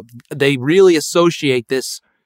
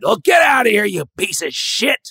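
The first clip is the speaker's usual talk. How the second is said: mock furious elders; 9 semitones higher than usual. Elders banishing the would-be artist, mock furious. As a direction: berate him like a disgraced heir. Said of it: "and they're so mad".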